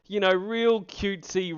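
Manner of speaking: normal talking voice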